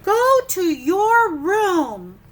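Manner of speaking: loud, slow go to your room; slow loud scolding; stern parent. Acted. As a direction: banish him sternly. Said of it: "parent's line from the book".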